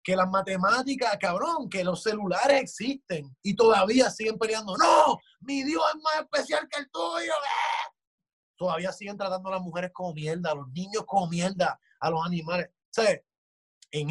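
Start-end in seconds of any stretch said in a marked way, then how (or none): 4.8-7.9 hammed-up indignant yell; mock zealot shout. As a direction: shout it like a petty fanatic defending his god. Mocking religious zealots arguing.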